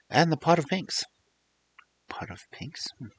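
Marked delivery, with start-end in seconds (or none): 2.1-3.1 a hushed wondering question; quietly musing over the title; whispered puzzlement. Real, not acted.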